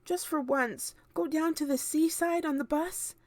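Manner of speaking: put-on a lonely child begging; James pleading. A narrator's reading. James begging his aunts, high and pleading. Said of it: "James cried out".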